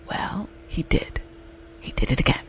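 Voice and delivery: hushed, breathy "well, he did"; exasperated whisper. Real, not acted. Wife whispering that her ex did it again. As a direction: drop to a whisper of disbelief. Ex repeats the mistake.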